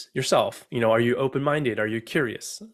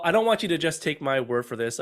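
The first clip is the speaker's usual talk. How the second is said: ordinary delivery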